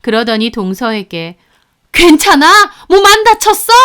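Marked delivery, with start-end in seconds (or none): 1.9-3.7 high, loud fussing; worried, raised-pitch questions; alarmed concern. Acted. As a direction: check urgently whether they're hurt. Mother-in-law fussing over pregnant daughter-in-law.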